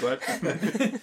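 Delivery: ordinary talk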